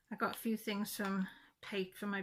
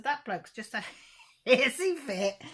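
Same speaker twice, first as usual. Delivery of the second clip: laughing through the words, louder; 6 semitones higher, 11 dB louder than usual. Real, not acted. Telling Dad about the neighbour's tantrum, laughing. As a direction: giggle it out.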